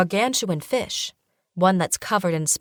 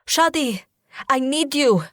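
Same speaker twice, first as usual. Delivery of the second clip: high, loud summons; urgent call; 7 semitones higher, 4 dB louder than usual. A narrator's reading. Heroine summoning the genie from the lamp, urgent. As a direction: call him out fast.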